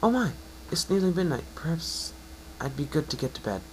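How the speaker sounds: high, bright-voiced mare, encouraging; a kind unicorn reassuring her patient; Twilight's voice.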